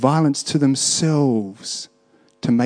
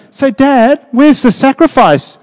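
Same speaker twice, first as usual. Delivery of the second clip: put-on higher, lighter voice of a curious boy; the son asking; 10 semitones higher, 5 dB louder than usual. Abraham's son asking his dad on the hill, high and innocent. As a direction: ask Dad innocently, a young son's voice. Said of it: "and even the son goes".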